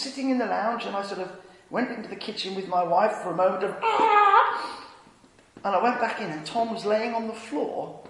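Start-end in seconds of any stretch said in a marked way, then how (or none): 3.8-4.9 high, loud yelp; comic high scream of panic; mock panicked scream. Preacher fleeing to the kitchen from Tom, mock terrified yelp. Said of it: "I was terrified of Tom coming".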